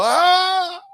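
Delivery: sudden high scream; a short, shrill cry as the building falls; retold scream, very high; scream cut off. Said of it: "You hear him go".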